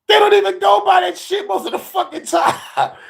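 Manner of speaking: high shouted rant. Real, not acted. Comic shouting that people ignore the rules. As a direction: yell it in disbelief.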